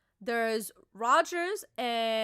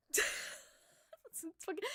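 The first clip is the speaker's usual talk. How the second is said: laughing; 6 semitones higher, 9 dB quieter, much slower than usual. Real, not acted. Laughing at the feat.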